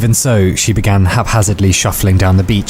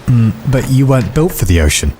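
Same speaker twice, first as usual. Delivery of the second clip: small, higher, timid voice; mousy; 4 semitones higher than usual. A narrator's reading. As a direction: object meekly, like a little mouse. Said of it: "He said mousily".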